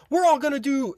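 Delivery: high-pitched imitation of an order; mock commanding instructor. Instructors announcing punishment to the squadron, mock commanding.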